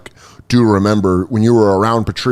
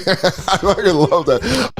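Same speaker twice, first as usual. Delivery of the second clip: laughing; 7 semitones higher, slower than usual. Real, not acted. Laughing at the bit.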